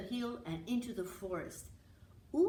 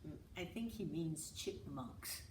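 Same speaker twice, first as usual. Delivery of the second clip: soft low aside, much quieter; whispered; 3 semitones lower, 7 dB quieter than usual. Acted. Finn quietly corrects little brother to Leo, soft low aside. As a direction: lean in and murmur it. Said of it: "whispered Finn to Leo".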